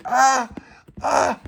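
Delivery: high, loud acted yells; straining cries. As Pauline pulled, straining cries. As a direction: yell with each heave.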